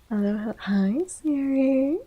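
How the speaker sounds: normal talking voice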